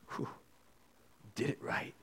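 whispered in reverence; hushed whisper. Real, not acted. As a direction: drop to a whisper. Hushed emphasis after a pause.

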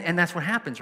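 ordinary delivery

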